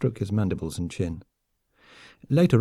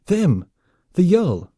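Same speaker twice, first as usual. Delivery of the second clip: higher, louder urgent cry; panicked alarm; 9 semitones higher, 6 dB louder, much slower than usual. A narrator's reading. Fleeing soldier naming the enemy, panicked. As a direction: blurt it out in alarm.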